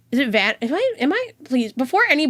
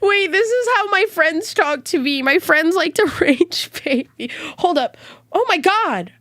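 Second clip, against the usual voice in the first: laughing; 7 semitones higher than usual. Real, not acted. Laughing at friends' banter.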